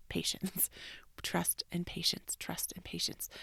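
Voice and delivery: soft whisper of trust and patience; whispered mantra. Real, not acted. Reminding herself to wait for the calendar, whispering. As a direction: breathe the words out like a reminder. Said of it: "whispered reminder to self".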